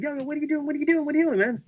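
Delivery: high-pitched, urgent repeated question; high mock panic; retold alarmed protest. Retelling a wrestler's alarmed protest.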